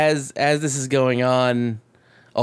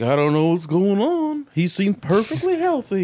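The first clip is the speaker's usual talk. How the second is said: high, rising baffled voice; hammed-up doctors at a loss, climbing in pitch; clueless doctors; 7 semitones higher than usual. Doctors telling family they don't know what's wrong, baffled. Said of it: "the doctors are obviously like".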